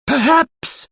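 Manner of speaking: high, slow wizard voice; a fussy old wizard delivering judgement. A narrator's reading. Wizard voicing judgement on Mister Stingy.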